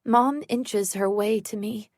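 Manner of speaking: plain reading voice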